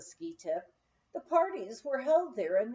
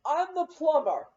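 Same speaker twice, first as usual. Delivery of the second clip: loud, higher put-on workman voice; costumed actress booming her fake plumber line; plumber's announcement; 5 semitones higher, 8 dB louder than usual. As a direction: boom it like a disguised plumber making an entrance. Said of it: "he stated".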